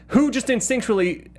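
ordinary talk

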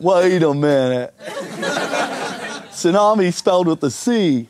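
slow mock Southern drawl; American Southern accent. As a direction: imitate a puzzled Southern heckler, drawn out. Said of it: "I did that joke down south in America".

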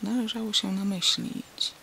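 usual speaking voice

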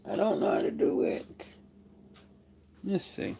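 hushed whisper to herself. Real, not acted. Whispering aside to her viewers. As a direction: breathe it under her breath.

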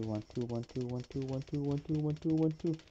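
ordinary delivery

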